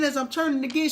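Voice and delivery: usual speaking voice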